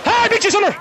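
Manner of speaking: high-pitched victory cry; triumphant gloating. Real, not acted. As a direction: crow over winning the race. Wins a swimming race.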